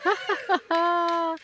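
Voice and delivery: high staccato ha-ha laugh; ha ha laughter. Shown as a demonstration. Instructor leading the group in laughing it out. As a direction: laugh it out in short beats. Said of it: "Keep laughing".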